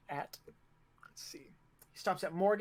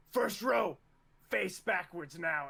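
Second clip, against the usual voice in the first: raised drill command, louder at the start; commanding order; 2 semitones higher, 3 dB louder than usual. Acted. Officer ordering the first row around, raised commanding voice.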